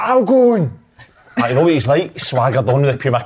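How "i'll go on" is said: high, loud retold volunteering; eager mock shout. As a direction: pipe up loudly, imitating a keen teammate.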